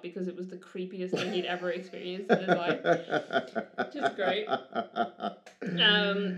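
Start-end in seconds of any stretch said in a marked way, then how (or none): 2.3-6.4 breaking into laughter mid-sentence; laughing. Real, not acted. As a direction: laugh through the words, a bit louder. Laughing at the creepy story.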